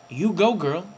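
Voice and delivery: quick, rising on "go"; tossed-off "you go, girl" with a lift; mock sassy cheer. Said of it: "Or whatever they do".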